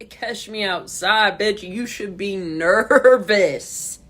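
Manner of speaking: slow, loud, menacing taunt; taunting threat. Real, not acted. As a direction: drag out the threat, daring her.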